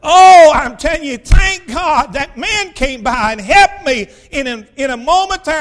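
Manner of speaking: loud, high preacher's outburst; fervent thanks. Real, not acted. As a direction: cry out gratitude from the pulpit. Preacher exclaims thanks to God.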